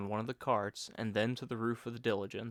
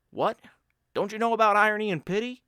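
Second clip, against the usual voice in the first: raised, mock astonished friend; Bill's voice; 10 semitones higher, 10 dB louder, faster than usual. Bill mocking his friend's ignorance, raised and surprised. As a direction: play the teasing friend amazed anyone missed it.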